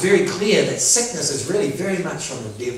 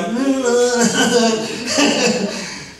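mock clueless higher-pitched babbling; oblivious nonsense babble; 6 semitones higher than usual. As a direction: babble on, still not hearing. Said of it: "Brett's still like".

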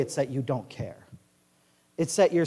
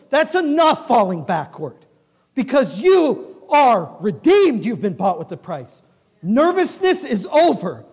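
preaching at full force; 7 semitones higher, 8 dB louder than usual. Acted. Preaching toward the close.

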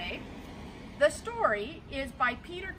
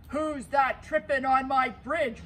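slow, loud troll roar; troll roaring at the little goat; 6 dB louder than usual. Acted. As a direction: boom it out like a troll under the bridge. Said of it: "roared the troll".

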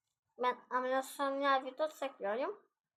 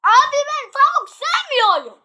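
very high, very loud protest; exasperated yell; 13 semitones higher, 14 dB louder than usual. Real, not acted. Player protests about chicken to friend, exasperated yell. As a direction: scream the complaint at full tilt.

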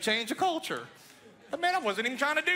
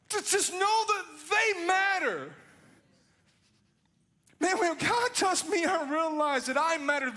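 climbing sermon fervor, shouting to the crowd; preaching at full force; 3 semitones higher, 4 dB louder than usual. Acted. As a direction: preach it like a revival altar call. Preaching builds over music.

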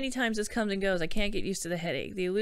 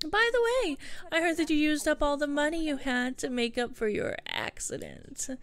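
high, taunting character voice; needling sibling figure; 6 semitones higher than usual. Acted. Sister confronting her brother over spent money, high and needling. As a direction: play a meddler in a high-pitched voice.

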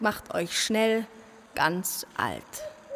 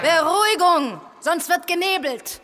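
calling to order; 7 semitones higher, 5 dB louder than usual. Acted. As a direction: shout the room down. Chair calls assembly to order.

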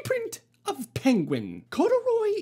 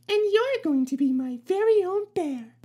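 put-on little girl voice; 8 semitones higher than usual.

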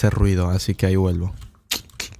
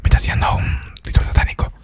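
unvoiced whisper throughout; ASMR whisper; 7 semitones lower than usual. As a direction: whisper chat close to mic.

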